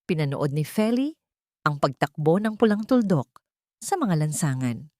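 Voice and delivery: usual speaking voice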